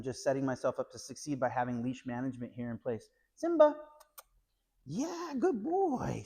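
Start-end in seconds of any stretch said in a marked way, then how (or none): 3.3-3.7 high, bright name call; calling the dog. Real, not acted. Trainer calling the puppy, high sing-song name. 4.8-6.2 high sing-song praise. Real, not acted. Trainer praising the puppy, high and stretched out.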